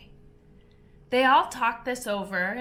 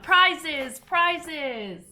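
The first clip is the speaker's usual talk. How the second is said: higher, louder chanting crowd; calling out; 7 semitones higher, 6 dB louder than usual. A narrator's reading. The party crowds Alice, calling out eagerly. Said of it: "calling out".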